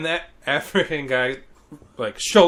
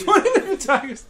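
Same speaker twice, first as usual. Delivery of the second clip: laughing repeat; 11 semitones higher, 4 dB louder, faster than usual. Real, not acted.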